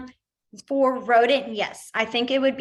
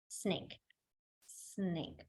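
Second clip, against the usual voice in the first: whisper; 4 semitones lower, 15 dB quieter than usual. Shown as a demonstration. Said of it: "whispered sign demo".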